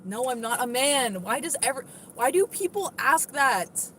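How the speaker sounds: usual speaking voice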